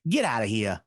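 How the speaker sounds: put-on low New York brush-off in an accent. Co-host jokes about debt collectors being turned away, in a New York accent. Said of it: "in my best New York accent".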